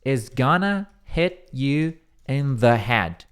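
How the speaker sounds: each word spelled out slowly; careful slow model phrase; slow dictation. Shown as a demonstration. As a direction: read it slowly for the students. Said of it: "Então, a frase é".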